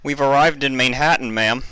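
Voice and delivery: put-on a polite young assistant reporting; eager young stallion; young assistant colt. A narrator's reading. Assistant voiced in story.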